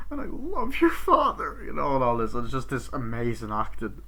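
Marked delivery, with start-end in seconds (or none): 0.1-1.6 much higher-pitched mock protest; high, dramatic movie-parent voice; imitated earnest plea. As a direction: act out a parent insisting, voice shooting up. Acting out the parents' quarrel.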